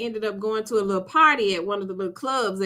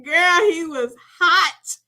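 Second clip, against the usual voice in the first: laughing through it, slower and higher; 6 semitones higher, 4 dB louder, slower than usual. Real, not acted. Telling a friend how hot he looked, laughing. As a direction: gush and laugh about how fine he looked. Gushing over the new guy.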